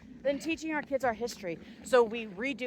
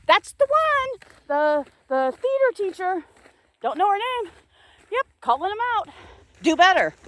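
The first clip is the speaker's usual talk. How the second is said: loud, high calling-out tone; indignant raised voice; angry call-out; 7 semitones higher, 7 dB louder than usual. Real, not acted. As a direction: call them out to their faces. Calling out the school.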